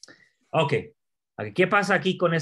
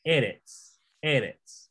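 Eretz with the stress on the first E; E-retz, weight at the front; first-syllable stress; much slower than usual. Shown as a demonstration. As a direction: hit the opening syllable of Eretz, then drop. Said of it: "la primera sílaba".

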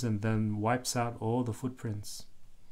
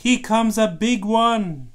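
loud, high, thrilled shout about the wave; excited call; 9 semitones higher, 8 dB louder than usual. Acted. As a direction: call it out like a kid spotting a huge wave. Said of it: "and the book says".

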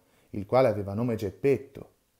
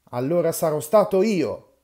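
loud, higher sarcastic retort; sarcastic anger; 6 semitones higher, 8 dB louder than usual. Acted. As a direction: fling it back sarcastically. Old men quarrelling again.

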